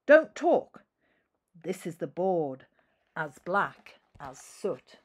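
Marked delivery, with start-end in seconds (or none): 0.1-0.8 high, loud strict teacher order; teacher barking at the class, loud and high; stern teacher. Acted. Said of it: "telling the class".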